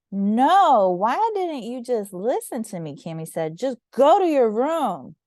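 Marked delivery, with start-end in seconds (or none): 0.1-2.9 exasperated scolding. A narrator's reading. Big sister scolds. 3.6-5.2 stern order. A narrator's reading. Big sister orders.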